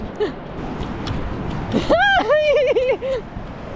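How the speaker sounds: shrieking laugh. Real, not acted. Shocked by cold water.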